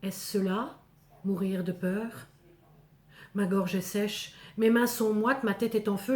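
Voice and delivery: plain reading voice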